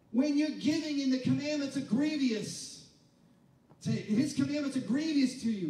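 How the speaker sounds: loud, high impassioned preaching; shouted gospel climax; preaching at full force. Acted. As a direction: build the congregation up, full voice.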